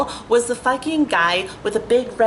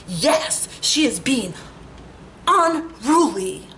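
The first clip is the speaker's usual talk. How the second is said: imitating the driver's reply, unruly drawn out high and slow; lightly voiced smug driver, stretching the last word; mocking the driver; 3 semitones higher, much slower than usual. Driver answering the instructor, mock smug drawl. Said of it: "Like, just like that".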